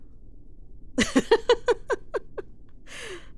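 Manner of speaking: a playful breathy laugh; amused laugh. Acted. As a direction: laugh at their wounded pride. Teasing about dulled skills.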